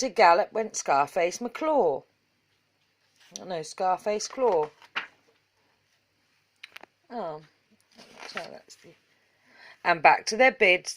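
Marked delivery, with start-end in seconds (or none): none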